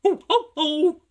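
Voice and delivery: very high, loud wailing hoot; jeering trucks. A narrator's reading.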